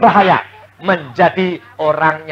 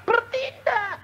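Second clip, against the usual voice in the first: high cry; 8 dB quieter, slower than usual. Retold or imitated. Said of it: "Kalau Tuhan mengatakan".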